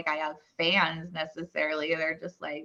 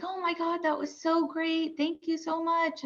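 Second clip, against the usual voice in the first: high, breathy-free gushing praise, higher pitch; gushing fan; 9 semitones higher, 5 dB quieter than usual. Retold or imitated. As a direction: gush like a thrilled client. Client gushing thanks.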